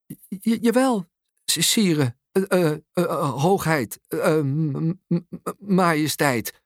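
flustered stammer with many false starts. A narrator's reading. Mum awestruck before the king, stammering. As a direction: stumble over each title, hesitating. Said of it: "hakkelt mama".

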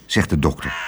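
usual speaking voice

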